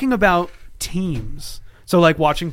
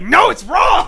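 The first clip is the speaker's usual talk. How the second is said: high, louder mock scold; imitating Leanne; 12 semitones higher, 5 dB louder, much slower than usual. Mocking his wife over team grammar, high scolding. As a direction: squeak it out as a cranky wife. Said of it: "And she's like".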